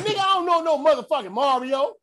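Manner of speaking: high mock cop voice; lightly voiced officer; gruff cop. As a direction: snap back like a confused armed cop. Officer voiced, gun drawn.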